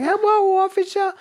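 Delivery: very high put-on simpleton greeting. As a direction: put on a childlike, impaired-sounding squeal.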